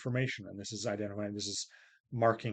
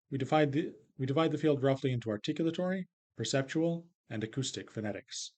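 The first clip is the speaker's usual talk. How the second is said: "articulatory" and "perceptual" held high, "acoustic phonetics" drops; list intonation; 2 semitones higher than usual. Shown as a demonstration. Lecturer reading a sentence to illustrate list pitch, high then falling. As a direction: read the list high until a final fall on "phonetics". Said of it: "when we start the list, the pitch goes high".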